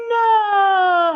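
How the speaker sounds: very high, loud wail of despair; anguished cry. A narrator's reading. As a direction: cry out as the arrow falls. Arrow dropped into the well.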